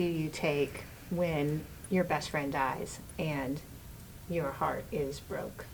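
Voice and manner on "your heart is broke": normal talking voice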